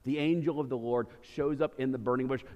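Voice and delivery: normal talking voice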